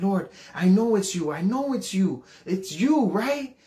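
high, pleading, seeking reassurance; plead, rising to an uncertain question; doubting plea. Retold or imitated. Confused teenager praying at the back door, pleading.

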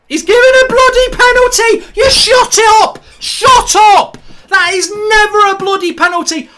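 screaming in outrage at the referee; furious shout. Real, not acted. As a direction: yell it in real fury, voice cracking high. Fan furious at penalty decision.

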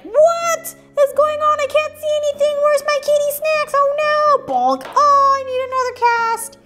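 high-pitched, frantic cartoon cat voice; panicked kitty. Hurt kitty in a cone wailing about snacks, high and panicky. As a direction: squeal it like a hurt little cat. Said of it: "This kitty's eyeball's like".

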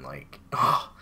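whispered, low groan of disgust; exasperated. Real, not acted. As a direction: breathe out the disgust. Exasperated about the challenge.

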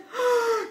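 high, sharp acted gasp; storybook gasp of alarm; farmer's startled gasp. A narrator's reading. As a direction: gasp as if woken with a shock. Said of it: "the farmer woke and said".

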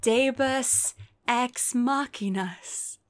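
drawn-out slow punchline; slow pun. Real, not acted. Deadpan pun on the buses, slow. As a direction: savour the pun slowly. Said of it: "I guess you could call them".

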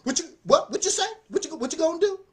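high, loud mock crazy challenge; acting crazy. Bully victim pretending to be crazy, high and loud. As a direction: yell it like a fake madman squaring up to the bully. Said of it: "make 'em think that you're crazy".

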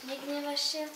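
one tree whispering to the other. A narrator's reading. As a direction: whisper it gently. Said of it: "Szepce drzewo do drzewa".

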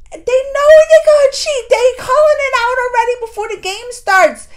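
high, loud, indignant outrage; outraged at the obvious cheating; worked up. Real, not acted.

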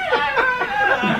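put-on high, loud laughing baby squeal; high laughing baby cry. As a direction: shriek like a button-mashing baby.

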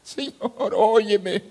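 high, stretched plea; re-enacted anguished prayer; crying out to God. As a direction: beg to be heard.

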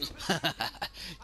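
high, cheeky cackle; gleeful laugh. Pretend. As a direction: laugh as you get away with it. Laughs after robbing the till.

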